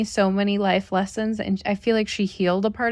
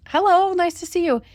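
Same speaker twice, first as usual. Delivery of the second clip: much higher, startled polite hello; surprised greeting; 10 semitones higher, 3 dB louder than usual. Retold or imitated. Greeting the neighbor who knocked without texting, in a high startled hello. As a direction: squeak out a flustered welcome.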